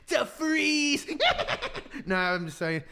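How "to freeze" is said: high villainous cackle; hammed-up evil glee, pitched high; maniacally evil. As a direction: cackle around the words like a cartoon villain. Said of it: "maniacally evil".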